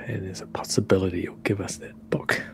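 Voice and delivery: hushed aside to the group; whispering. Acted. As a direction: lean in and whisper it.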